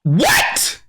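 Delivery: shrieking outrage; shocked Trunks. Retold or imitated. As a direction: react as if your dad just lost it. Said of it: "Trunks is like".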